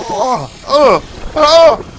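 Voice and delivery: high, loud panicked groans; terrified of being swallowed. Acted. Oster panics as the mound swallows him, loud high panic.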